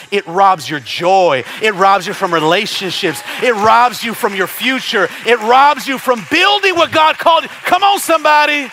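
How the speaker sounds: from firm to high, loud "Come on, somebody"; building preacher's cry. Acted. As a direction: climb each line until you cry out.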